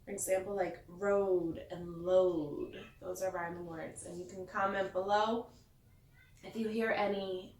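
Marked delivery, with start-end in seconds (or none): 0.5-2.7 road and load drawn out slowly; teacher pointing out the rhyme; slow rhyme demo. Shown as a demonstration. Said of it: "listen to the rhyming words".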